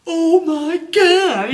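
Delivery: imitating a guest fed up with his friends; exasperated groan. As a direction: stretch it out in total frustration. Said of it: "he's just like".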